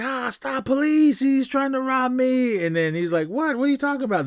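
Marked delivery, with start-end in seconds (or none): 0.0-2.6 high, drawn-out cry for help; mock panicked cry. Man calling police on supposed robber, mock panic. 3.3-4.2 high-pitched incredulous protest; mock disbelief. Accused man protesting to his accuser, mock disbelief.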